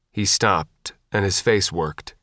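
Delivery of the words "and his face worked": usual speaking voice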